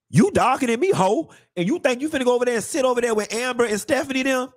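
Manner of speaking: sassy call-out. Acted. Mock telling off a friend.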